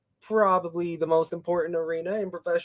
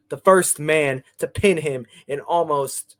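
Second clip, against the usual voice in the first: ordinary talk, nothing marked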